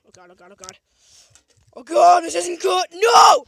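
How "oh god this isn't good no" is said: much higher and louder anguished yell; real frustrated wail; despairing shout. Real, not acted. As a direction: cry out at the loss. Losing power-up.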